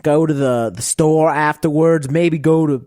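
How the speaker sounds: put-on Southern US accent. Shown as a demonstration. Podcaster doing requested impression for co-hosts, broad Southern accent. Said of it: "the Southern accent?".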